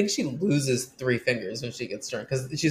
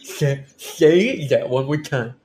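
slow, loud slushy sh for s; Dorinda impression; 2 semitones higher, 6 dB louder, slower than usual. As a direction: drag it out, lisping. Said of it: "doing some of our best Dorinda work".

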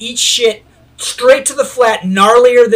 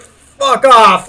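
loud, higher sleepy snarl; grumpy yell; 4 semitones higher, 7 dB louder than usual. Retold or imitated. Friend still in his tent, loud annoyed yell. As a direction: shove him away with your voice.

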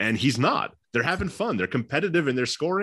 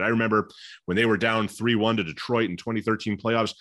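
ordinary delivery

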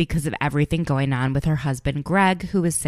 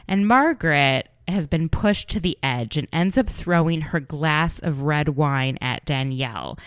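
ordinary delivery

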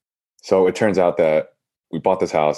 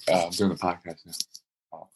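speaking away from the mic, softer; turned away from phone; 8 dB quieter, slower than usual. Real, not acted. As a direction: turn aside and talk off mic. Aside to wife off mic.

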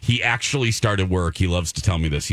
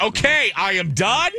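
exasperated; 11 semitones higher than usual. Retold or imitated. Exasperated retort to husband.

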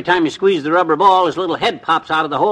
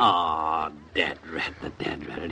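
disappointed groan; 9 semitones lower, 10 dB quieter, much slower than usual. Acted. Chipmunk escapes, he's let down.

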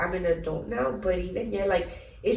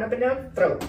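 ordinary talk

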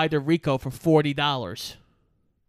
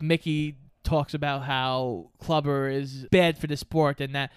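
usual speaking voice

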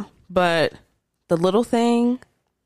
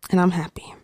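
whispered; 4 semitones lower than usual. Real, not acted. Whispered happy aside.

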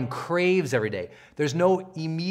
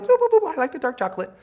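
much higher and louder, sing-song boops; a giddy inner voice tasting its treat; the mind's voice cheering a bite of chocolate, high and playful; playful delight; 10 semitones higher, 4 dB louder, faster than usual. Pretend.

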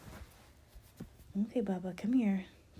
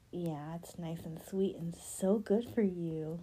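usual speaking voice

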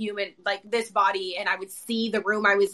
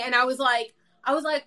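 normal talking voice

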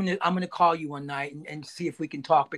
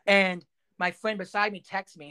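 ordinary talk, nothing marked

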